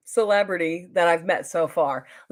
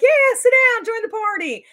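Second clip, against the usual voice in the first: loud, high-pitched invitation, easing at the end; excited welcome; 7 dB louder than usual. Retold or imitated. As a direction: re-enact waving a newcomer over with gusto. Retold eager welcome to newcomer.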